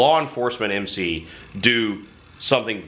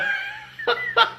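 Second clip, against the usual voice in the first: high chuckle mid-sentence; real amused laughter. Real, not acted. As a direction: break off into laughter. Laughs while telling the story.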